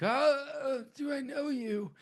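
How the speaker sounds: hammed-up confused doctor; confused old doctor. Loomis puzzled when stranger greets him.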